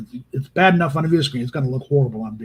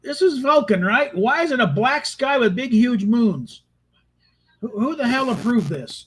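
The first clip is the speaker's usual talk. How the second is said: incredulous exec voice, a bit higher; mock studio exec outrage; 7 semitones higher than usual. Imitating a baffled executive scoffing at the Vulcan set. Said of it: "it's like".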